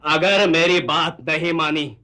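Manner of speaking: dramatic threat. Shown as a demonstration. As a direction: declaim it weightily, word by word. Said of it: "और कहिए".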